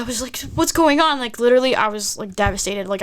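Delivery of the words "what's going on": raised, urgent retold question; breathless panic. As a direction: reenact panicked confusion. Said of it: "I could not breathe".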